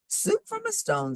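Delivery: put-on very high old lady voice, amazed. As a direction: pipe it up like a wondering granny. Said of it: "said the little old lady".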